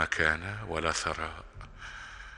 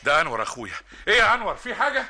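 louder, higher, laughing; excited greeting; 14 semitones higher, 8 dB louder, faster than usual. Acted. Brother calls out excitedly when Anwar arrives.

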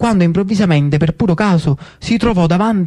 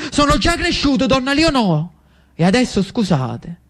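much higher, offended youth; indignant retort; 7 semitones higher than usual. Acted. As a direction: snap back then leave. Lucio retorts to Pimentel.

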